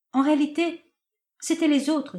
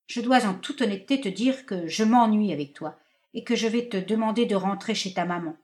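ordinary delivery

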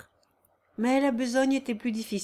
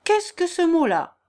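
louder, high indignant question; frowning displeasure; 8 semitones higher, 7 dB louder than usual. A narrator's reading. Father objecting to his son's vague word, sharp displeasure. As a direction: challenge the word sharply, pitch jumping up. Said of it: "en fronçant le sourcil".

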